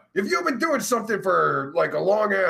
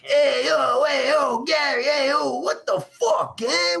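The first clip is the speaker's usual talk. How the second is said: hammed-up Italian-American hustler calling out to Gary; playful Italian-American accent, yelled high; mock Italian-American; 7 semitones higher than usual.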